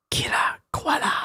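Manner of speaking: high, breathy whisper; hushed ominous aside; dramatic whisper. Acted. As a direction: breathe the name secretly. Whispered tag.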